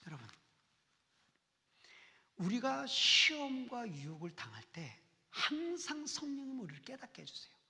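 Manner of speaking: ordinary delivery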